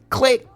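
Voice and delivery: a high, loud spoken click; sharp mock hang-up click; phone click. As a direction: mimic the phone hanging up. The caller hangs up.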